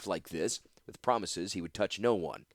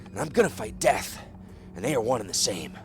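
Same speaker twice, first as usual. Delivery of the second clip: raised, intense voice of a sick man; feverish vow; 4 semitones higher, 3 dB louder than usual. Acted. Sick husband insists to his wife he will fight death. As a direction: swear it with fevered conviction.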